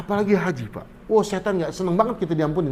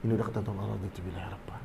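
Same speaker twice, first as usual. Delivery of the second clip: soft unvoiced whisper of acceptance; whispered prayer of acceptance; 9 semitones lower, 13 dB quieter, much faster than usual. Shown as a demonstration. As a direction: breathe it, barely voiced, like a quiet surrender.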